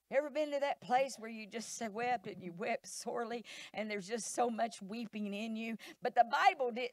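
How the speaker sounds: ordinary delivery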